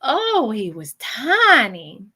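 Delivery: slow, stretched-out cooing; cooing over a baby. Retold or imitated. Medium repeating a spirit's fond remark on a baby, cooing. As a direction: linger on each word as if over a baby. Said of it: "that's exactly how I".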